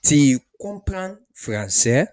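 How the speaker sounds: slow model question. Shown as a demonstration. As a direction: teach it slowly.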